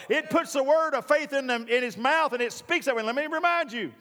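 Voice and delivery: usual speaking voice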